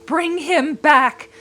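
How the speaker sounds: put-on high, loud, very slow cry from the old woman; desperate cry. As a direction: drag out each word in desperate demand. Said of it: "cried the old woman".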